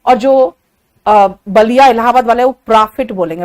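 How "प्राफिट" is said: प्रॉफिट as "PRAA-fit", open vowel; lower, open-mouthed प्रॉफिट; Allahabad-style pronunciation. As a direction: mimic प्रॉफिट with a wide-mouthed aa. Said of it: "वो मुंह और फाड़ देते हैं".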